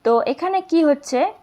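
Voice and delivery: ordinary talk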